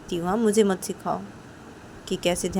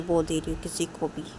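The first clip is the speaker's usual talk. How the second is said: ordinary delivery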